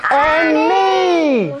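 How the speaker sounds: high, drawn-out defiant yell; group shout. A narrator's reading. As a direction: shout it out in solidarity. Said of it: "shouted the rest of the mothers".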